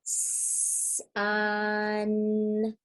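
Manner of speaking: sounds stretched out one by one, hissed S then long vowel; really slow. Shown as a demonstration. Teacher showing kids sounds to blend, really slow. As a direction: elongate and enunciate each sound. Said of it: "say a word really slowly".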